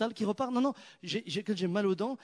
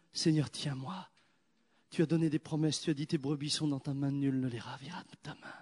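softer, lower pleading prayer; hushed, lower prayer voice; 3 semitones lower, 5 dB quieter than usual. Acted. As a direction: plead quietly to the Lord.

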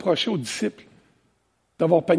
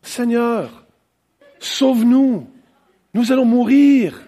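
acted plea for rescue, pitched up with each phrase set apart; cri au secours joué; 4 semitones higher, 3 dB louder, slower than usual. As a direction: read it as a staged cry for help, swelling to a loud end. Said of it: "je vais essayer de lire comment ça aurait pu se passer". (appel au secours joué, voix qui monte)

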